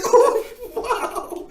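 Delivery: high squeaky laugh through the words. Real, not acted. Podcast host giggling over a sweet birthday kid, high laughing coo.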